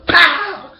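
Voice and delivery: loud high "pow" slap noise; imitated slap sound. Telling the crowd how the slap landed, a loud high pow.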